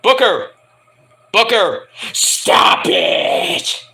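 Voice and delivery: Vince McMahon yelling. Pretend. Boss hissing into commentator's ear, strained yell. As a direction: bark into his ear like a furious boss. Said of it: "he goes to Booker T's ear and goes".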